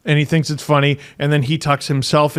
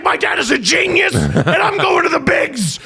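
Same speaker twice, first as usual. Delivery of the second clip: put-on high, shouted kid's brag building to a yell; exultant little son; 12 semitones higher than usual. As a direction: imitate a boy carried away with glory. Acts out son's triumphant rant.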